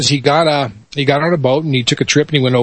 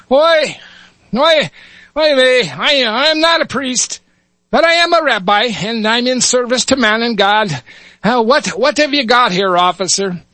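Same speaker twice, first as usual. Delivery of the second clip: put-on high Yiddish-accented little rabbi; 10 semitones higher than usual. Rabbi at back of crowd volunteers, Yiddish accent. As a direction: pipe up eagerly like an old rabbi. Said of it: "switch between dialects here".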